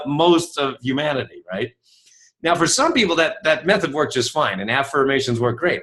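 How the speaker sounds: ordinary talk, nothing marked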